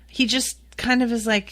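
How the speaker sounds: ordinary delivery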